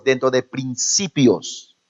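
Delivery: "dentro de principios" drawn out slowly; slow it down to land the point; slow emphasis. Shown as a demonstration. Preacher stressing principles to the congregation, slowly. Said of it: "Los afirma uno dentro de valores".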